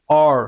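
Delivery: অর said with a rounded mouth. Shown as a demonstration. Teacher explaining O joined with R, round-mouthed অর. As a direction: round the lips on অর. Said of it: "গুল হবে".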